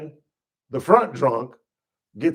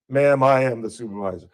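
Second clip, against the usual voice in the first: lower, fast deadpan reply; dry cop; 7 semitones lower, faster than usual. Retold or imitated. Officer calmly telling the woman he is the supervisor, lower. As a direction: cut her off dryly.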